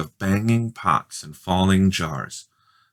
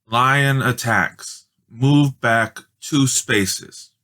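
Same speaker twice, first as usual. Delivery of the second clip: slow, higher, deliberate reading; reading the square; 4 semitones higher, 3 dB louder, much slower than usual. A narrator's reading. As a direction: spell out the message slowly. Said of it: "read Judy".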